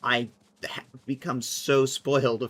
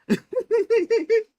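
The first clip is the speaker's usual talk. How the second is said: high squeaky giggle; 7 dB louder than usual. Real, not acted. Laughs at own typo.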